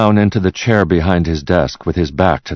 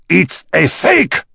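slow, high-pitched outraged shout; angry shout; 13 semitones higher, 3 dB louder, slower than usual. A narrator's reading. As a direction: shout it, stretching each word. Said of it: "shouted Fenston".

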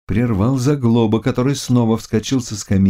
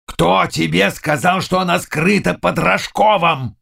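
raised, hoarse, urgent demand; 9 semitones higher than usual. Acted. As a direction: rasp out the question, pitched up. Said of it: "спросил хриплым голосом". (хриплый, напряжённый допрос)

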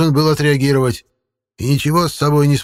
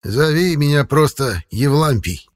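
chuckling; 6 semitones higher, 3 dB louder, slower than usual. Acted. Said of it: "усмехнулся старик".

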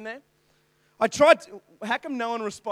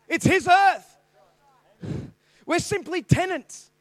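preaching; 5 semitones higher, 6 dB louder than usual. Acted. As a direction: proclaim to the congregation. Preaching at full force.

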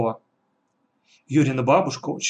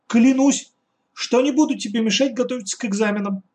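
put-on girl's solemn oath; 9 semitones higher than usual. A narrator's reading. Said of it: "объявила Катя прямо с порога".

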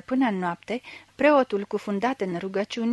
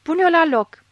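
high, loud shouted demand; desperate bellowing; 8 semitones higher, 8 dB louder than usual. A narrator's reading. Blind man demands the priest's hand back, desperate bellowing. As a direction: shout it urgently, voice raised right up. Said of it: "zbieră din răsputeri orbul".

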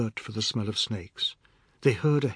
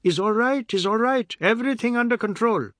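high, loud reassurance; shouting; 11 semitones higher, 6 dB louder than usual. A narrator's reading. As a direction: shout over the commotion. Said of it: "shouted to everyone".

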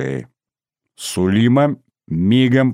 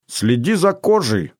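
higher mock-sinister warning; ominous; 7 semitones higher than usual. A narrator's reading. Rita teases Albina about her drink, mock-ominous. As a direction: warn with playful menace. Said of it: "зловещим голосом сказала Рита".

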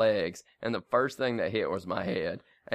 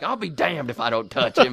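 a higher, louder mock shout of defiance; defiant retort; 6 semitones higher, 6 dB louder than usual. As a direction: fire it back like a stubborn friend. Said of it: "You're like".

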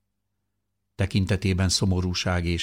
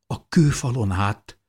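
higher, louder astonished echo; shocked disbelief; 5 semitones higher, 4 dB louder than usual. A narrator's reading. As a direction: repeat it back, stunned he climbed the wall. Mother repeats in shocked disbelief. (döbbent, hitetlenkedő visszakérdezés)

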